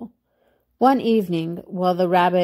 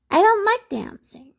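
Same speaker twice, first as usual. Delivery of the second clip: high-pitched toy-rabbit voice; high storybook refusal; reluctant little rabbit; 11 semitones higher than usual. A narrator's reading. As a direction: decline in a small high voice. Little rabbit declines to dance.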